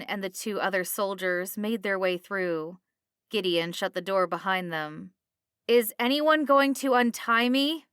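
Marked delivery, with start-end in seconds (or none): 5.7-7.9 high, loud complaint; indignant protest. A narrator's reading. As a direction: protest loudly at being left tied. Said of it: "Derville protested".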